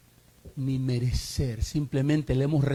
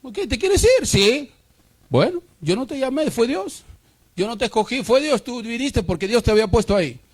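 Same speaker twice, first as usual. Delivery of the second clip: pastor's reply; 6 semitones higher, 3 dB louder than usual. Retold or imitated. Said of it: "pastor answers a leaving member".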